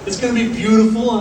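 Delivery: bright and loud, full of hype; upbeat cruise director. Real, not acted. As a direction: sell it like a cruise host. Captain hyping the new ship.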